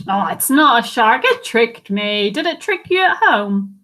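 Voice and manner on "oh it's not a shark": usual speaking voice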